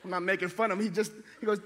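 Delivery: usual speaking voice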